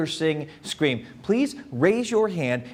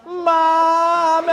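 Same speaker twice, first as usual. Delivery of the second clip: put-on high, loud panicked kid; terrified child calling mommy; scared cry for mommy; 10 semitones higher, 4 dB louder than usual. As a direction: wail for your mom. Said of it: "I was like".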